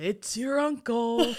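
slow, loud mock announcement; imitating video uncle. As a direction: draw each word out like the clip. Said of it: "he's like".

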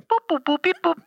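high beeping like dialing a phone; high comic phone beeps; beeping phone noises. Shown as a demonstration. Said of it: "That's the sound it makes".

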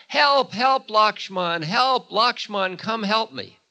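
a magician faking Ram's voice in panic, calling out; desperate yelling. Acted. Magician mimics Ram to lure Lakshman away, yelling desperately. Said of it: "turns his voice into the voice of Ram and starts yelling".

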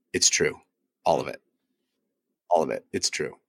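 low, soft, gruff movie-star imitation; Harrison Ford. Podcast host confirming the hype, in a low Harrison Ford voice. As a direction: do a hushed, low-pitched film hero. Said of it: "in my best Harrison Ford voice".

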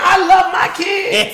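a proud stage dad yelling it out; Kev impression. Friend doing Kev onstage declaring love for his kids, high and slow. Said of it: "That's you, Kevin".

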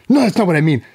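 high-pitched mock rant, not shouted; retold wife's scream. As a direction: imitate an irate wife, voice lifted well up. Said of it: "So she starts screaming".

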